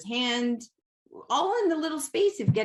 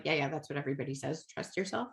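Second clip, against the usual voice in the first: dismissive; 5 semitones lower, 6 dB quieter, faster than usual. Retold or imitated. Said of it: "I would have been like".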